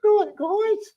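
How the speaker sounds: very high squeaky imitation; mimicking pet voice. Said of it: "But you were like".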